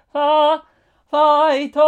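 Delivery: high full-voice shout of Fight. Acted. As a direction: belt it out like a cheer. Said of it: "こうやって叫んでもオッケーなんだ".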